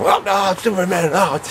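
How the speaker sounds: high, giddy Superman squeal; mock fanboy gush. Replaying his gaffe to Christopher Reeves, high giddy squeal. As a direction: squeal like a starstruck fan.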